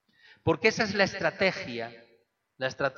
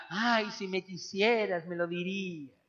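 coaxing Delilah; 4 semitones higher than usual. Retold or imitated. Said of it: "Y la otra". (Dalila melosa, imitada con voz aguda)

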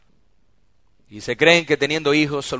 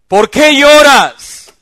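much higher and louder, drawn out slowly; loud mocking question; 13 semitones higher, 17 dB louder, much slower than usual. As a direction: fling the question at her. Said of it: "mujeres llorando".

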